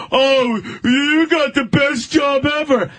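high, drawn-out mocking gush; mock envy. Voicing naive people envying the actor.

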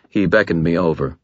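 usual speaking voice

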